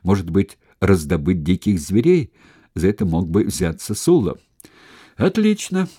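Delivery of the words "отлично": exclaiming. A narrator's reading. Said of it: "воскликнул Сципион".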